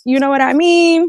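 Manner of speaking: high-pitched put-on voice; playful high impression of someone; Messy Steph. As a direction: say it in a high, drawn-up mimicking voice. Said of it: "In my Messy Steph voice".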